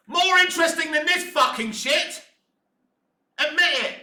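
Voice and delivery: furious fan yelling at the screen; angry outburst. Real, not acted. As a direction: shout it at the showrunners. Ranting at the show.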